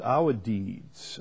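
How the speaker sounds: ordinary talk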